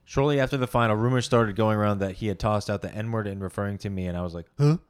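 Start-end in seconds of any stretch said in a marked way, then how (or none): none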